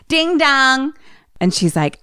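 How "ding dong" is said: high, sung two-note chime; doorbell imitation. Doorbell interrupting babysitter's warning, sung chime. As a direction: ring out like a doorbell.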